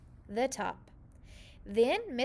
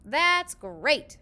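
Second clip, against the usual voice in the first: louder, delighted and bright; gleeful cry; 4 semitones higher, 8 dB louder than usual. A narrator's reading. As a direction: cry out with glee at the fix. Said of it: "cried with glee".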